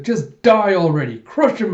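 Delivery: loud, high acted yell; angry outburst. Koyomi urges Den against her father, loud angry yell.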